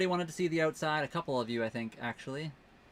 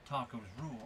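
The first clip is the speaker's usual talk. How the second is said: quieter, half-hearted stab at a Bush accent; attempted George W. Bush accent; 8 dB quieter than usual. Shown as a demonstration. [name] obliging a viewer's request, unsure Bush accent. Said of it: "I can't do that accent".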